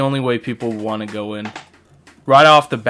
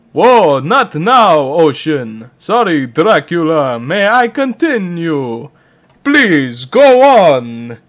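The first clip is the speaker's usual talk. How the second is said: hammy vampire with drawn-out, swooping words; Dracula; 2 semitones higher, 4 dB louder, slower than usual. Pretend. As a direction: ask to continue like a theatrical vampire count. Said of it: "Dracula will be voiced by Dracula".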